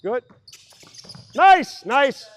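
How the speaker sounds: loud, high burst of praise. Real, not acted. Coach praising players after a good play, loud and high. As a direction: shout approval across the gym.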